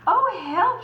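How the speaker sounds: high, loud frightened squeak; shivering pig. Acted. Horace frightened as the wolf climbs the roof. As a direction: gasp it in fright. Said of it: "shivered Horace".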